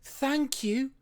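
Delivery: high, bright thank-you; grateful thanks. A narrator's reading. Pup thanking his helpers as he leaves, high and glad. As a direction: call it back happily.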